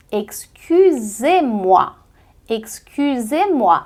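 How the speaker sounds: excusez-moi slow, ending on a clear é; slow French drill. Shown as a demonstration. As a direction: stretch excusez-moi, é at the end. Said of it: "ficam som de E". (excusez-moi devagar, som de é)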